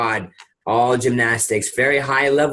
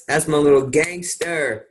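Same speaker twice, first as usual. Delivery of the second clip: ordinary talk, nothing marked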